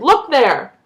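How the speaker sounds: loud, pointing exclamation. A narrator's reading. Grandpa showing the newspaper to Billy, loud. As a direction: call it out loud. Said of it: "in a loud voice".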